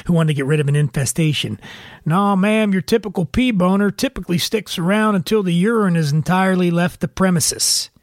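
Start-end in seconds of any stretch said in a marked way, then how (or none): 2.0-7.9 exterminator voice. Retold or imitated. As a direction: play a deadpan pest man. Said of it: "some nightmare exterminator in my head".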